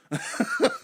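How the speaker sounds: a sudden high squeal of a laugh; high squeaky laugh. Real, not acted. Laughing at his own tea count with a high squeal. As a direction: squeak out a giggle.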